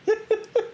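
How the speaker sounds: very high burst of laughter; a quick squealing laugh. Real, not acted. Laughing at his own Awooga joke, high burst.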